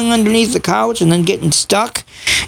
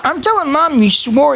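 higher, sing-song fake tattle; mock tattling; 6 semitones higher than usual. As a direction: pretend to snitch to mom, playful.

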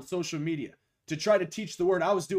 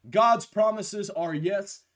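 slow, louder preached declaration; preaching; 3 semitones higher, 5 dB louder, slower than usual. Acted.

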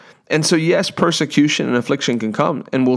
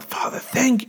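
rejoicing prayer; 11 semitones higher, 5 dB quieter, slower than usual. Retold or imitated. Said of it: "rejoice from your spirit".

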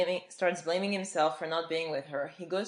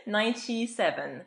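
air quotes; 5 semitones higher, 6 dB louder than usual. Real, not acted. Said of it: "put some air quotes".

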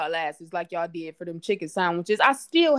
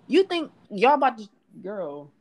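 ordinary talk

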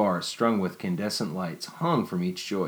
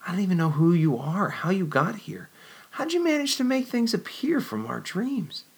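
much higher, rushing wonder; excited questions; 12 semitones higher than usual. Acted. Jennifer amazed at Nicholas, asking much higher.

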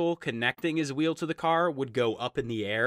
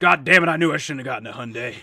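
grumpy dad voice; 3 semitones lower, 5 dB louder, faster than usual. Acted. Dad voiced grumbling about son.

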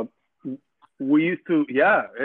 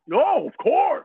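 high, slow mocking shout; hammed-up indignant cry; mock offended shout; 12 semitones higher, 6 dB louder, slower than usual. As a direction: ham up an offended believer.

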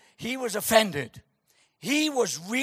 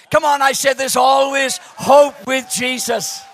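rallying shout; 3 semitones higher, 11 dB louder than usual. Acted. Preacher rallying the congregation.

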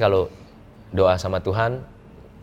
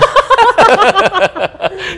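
long, loud, high guffaw; roaring laugh; 12 dB louder than usual. Real, not acted. Guest after joking "siapa yang hamilin", roaring laugh. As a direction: burst out laughing at your own punchline. Punchline of who got her pregnant.

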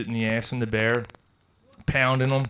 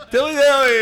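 high, loud "Dilly dilly" call; hammed-up ad catchphrase; 12 semitones higher, 10 dB louder than usual. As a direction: belt it like a medieval toast. Echoing the beer-ad catchphrase.

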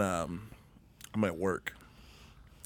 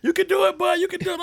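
loud, much higher mock cheer; coworker cheering; 7 dB louder, faster than usual. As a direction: coach him through it like a trainer. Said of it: "He's like".

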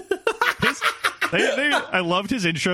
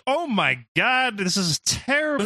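disgusted crowd; 7 semitones higher than usual. Retold or imitated. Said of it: "a group chant of".

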